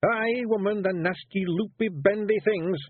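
high Scots growl, slowing on loopy, bendy; growling Feegle. A narrator's reading. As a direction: grumble at the letters like a wee warrior. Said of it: "growled Rob".

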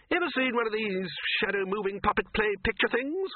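a hearty host waving off the hunt; Ridcully's voice. Acted. Ridcully asks bursar.

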